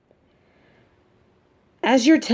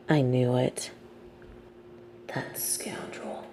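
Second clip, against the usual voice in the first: hushed seething whisper; 6 semitones lower, 11 dB quieter, slower than usual. Acted. Villain whispering a curse at his absent nemesis, hushed. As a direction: drop to a bitter whisper.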